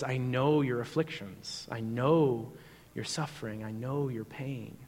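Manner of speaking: soft, slow, low voice of comfort; tender reassurance. Acted. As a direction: reassure them gently as Jesus would. Voicing Jesus comforting the suffering.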